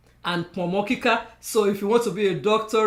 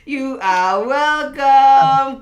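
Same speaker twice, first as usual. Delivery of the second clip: drawn-out welcome; 6 semitones higher, 9 dB louder, much slower than usual. Real, not acted.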